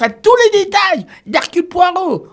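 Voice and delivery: high, loud admiring praise; excited praise. Real, not acted. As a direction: gush about him warmly. Compares host to Poirot.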